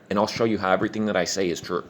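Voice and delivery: normal talking voice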